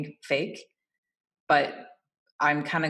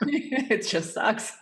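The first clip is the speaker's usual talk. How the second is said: laughing; 8 semitones higher, much slower than usual. Real, not acted. Laughing at own joke.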